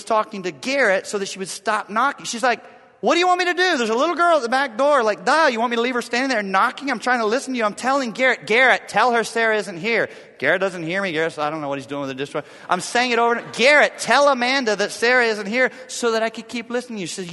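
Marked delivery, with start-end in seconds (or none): 3.0-10.1 exasperated. Retold or imitated. Voicing exasperated wife. 13.5-15.7 calling out. Retold or imitated. Calling out to son.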